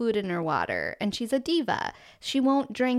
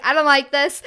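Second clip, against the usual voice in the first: louder, alarmed whine of a panicking husband; mock panic; 4 semitones higher, 8 dB louder than usual. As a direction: imitate him freaking out, loud.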